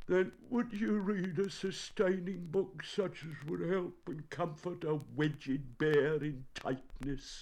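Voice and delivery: high, plaintive bear plea; tearful. A narrator's reading. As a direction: plead in a high, sorrowful voice. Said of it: "a tear rolled down".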